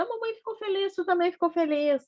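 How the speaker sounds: put-on happy baby talk. Shown as a demonstration. Mother to laughing baby, very high happy baby talk. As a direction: coo to the baby that everyone is happy. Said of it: "Ou tu dá uma risada".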